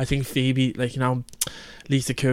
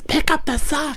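much higher squawked sitcom command; Phoebe; 14 semitones higher, slower than usual. Retold or imitated. Quoting a favourite Friends line to a friend, high mock command. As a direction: put on the character, pitched right up. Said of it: "I love when Phoebe says".

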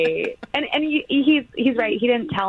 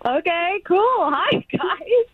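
high-pitched, cheery greeting; chipper; 12 semitones higher, much slower than usual. Real, not acted. Woman greeting morning radio hosts early, chipper. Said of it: "You sound so chipper".

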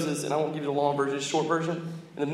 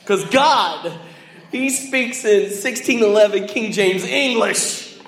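mock pompous; 2 semitones higher, 7 dB louder, slower than usual. Mock pompous claim about God.

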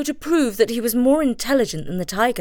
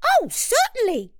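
very high-pitched chirpy monkey; squeaky, amused trickster monkey; gleeful monkey cry. A narrator's reading. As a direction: pipe up high with gleeful eagerness. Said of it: "cried the monkey, still laughing".

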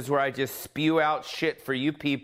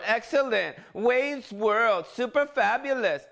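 Wayne's World impression; 8 semitones higher than usual. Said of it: "He was in Wayne's World".

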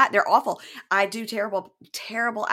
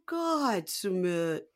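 singsong Jenny; 2 semitones higher, much slower than usual. Retold or imitated. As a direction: draw it out in a whiny impression. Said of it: "It's a lot of that".

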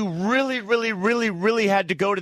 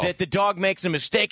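ordinary talk

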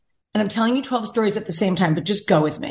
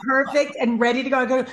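usual speaking voice

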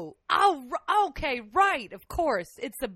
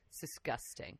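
much softer, lower, breathy whisper; 18 dB quieter than usual. Real, not acted. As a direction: murmur it under your breath in disgust. Whispered reaction.

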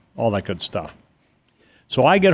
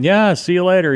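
higher and louder, a breezy goodbye call; cheery send-off; 8 semitones higher, 4 dB louder than usual. Retold or imitated. Son calling goodbye to parents as they drive off, breezy and eager. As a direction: wave them off, eager to get to the party. Waving off parents, ready to party.

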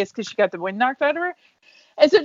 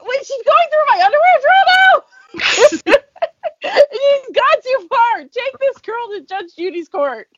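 high laughing shout, mock outrage; 12 semitones higher, 6 dB louder than usual. Real, not acted. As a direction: shout through laughter, scandalized.

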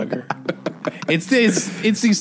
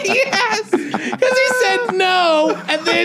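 squeaky, much higher laughing through the words; slower than usual. Real, not acted. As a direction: laugh it out, fast and high.

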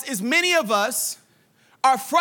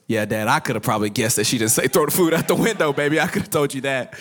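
much lower, fast mock voice; mock kid voice; 11 semitones lower, faster than usual. Son teasing dad from the back seat, deep mock voice. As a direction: tease dad in a deep put-on voice. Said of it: "I was like".

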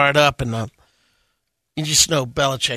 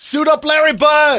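mock fan yell; 12 semitones higher, 4 dB louder than usual. Mock Boston fan yelling.

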